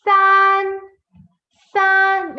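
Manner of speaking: SAN with an ah, held high, level and loud; correct SAN vowel. Shown as a demonstration. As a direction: stretch SAN as "saahn" on a high note. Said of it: "It's not san".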